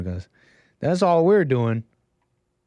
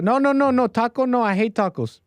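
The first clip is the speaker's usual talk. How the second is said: much higher, rapid-fire comic no's; panicky hiding woman; 8 semitones higher than usual. Pretend. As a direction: cut in with mock-frantic denial. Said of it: "she says she doesn't want tacos anymore".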